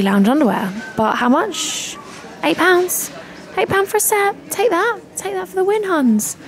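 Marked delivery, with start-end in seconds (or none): 2.4-6.4 high, gleeful gloat over a bargain; excited bargain cheer. Real, not acted. Vlogger crowing to her followers about an eight-pound set, gleeful. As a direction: crow about the price, punching the air.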